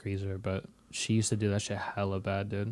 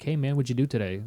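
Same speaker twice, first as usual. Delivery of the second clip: rushed normal talk; 4 semitones higher, faster than usual. Retold or imitated. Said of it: "you would say something like".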